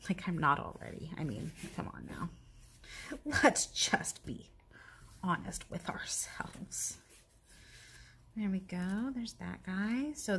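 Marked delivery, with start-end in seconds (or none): 3.4-7.0 slow joking whisper. Real, not acted. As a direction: whisper it like a secret. Whispered self-joke.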